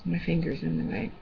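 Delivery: ordinary delivery, nothing marked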